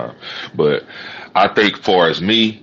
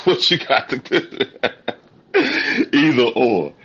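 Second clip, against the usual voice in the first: laughing; 8 semitones higher than usual. Real, not acted. Laughing at own joke.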